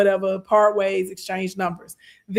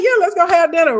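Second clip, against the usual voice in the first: higher, louder acted-out friend's reply; eager agreement; 8 semitones higher, 7 dB louder than usual. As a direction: jump in with a keen yes, voice raised.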